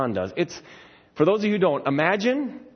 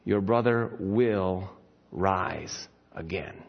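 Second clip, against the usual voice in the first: lower, slow, tender promise; gentle Jesus; 9 semitones lower, 4 dB quieter, much slower than usual. Retold or imitated. Jesus gently comforting grieving Martha, slow and soft. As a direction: say it softly and slowly, like comfort. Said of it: "and he says".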